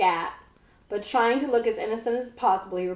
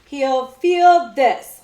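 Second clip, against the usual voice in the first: loud, very slow growl; thundered; 3 semitones higher, 10 dB louder, much slower than usual. A narrator's reading. Outlaw thunders a threat as he whips, slow and loud. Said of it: "Hold Your Nose Billy thundered".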